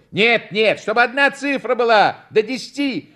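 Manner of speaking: lightly voiced fussy showman; clown correcting. A narrator's reading. Clown corrects the workman's number, insistent.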